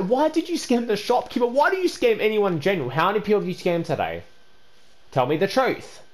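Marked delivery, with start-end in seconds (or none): none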